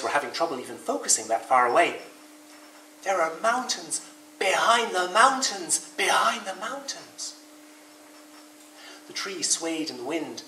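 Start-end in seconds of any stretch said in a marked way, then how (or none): 3.0-7.3 slow, high sing-song wonder; awestruck. A narrator's reading. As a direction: stretch it out in amazement, pitch swooping up. Rabbit amazed by distant mountains.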